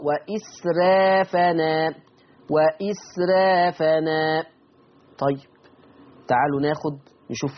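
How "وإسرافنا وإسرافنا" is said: وإسرافنا recited slowly with a thin ra; ترقيق الراء في وإسرافنا; thinned ra. Shown as a demonstration. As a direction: draw out وإسرافنا, keep the ra light. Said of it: "لا، رقق الراء".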